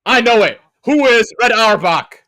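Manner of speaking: high, loud, slow answer; confident shout. Real, not acted. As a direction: belt out the answer proudly.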